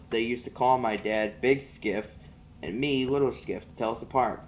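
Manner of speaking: ordinary delivery, nothing marked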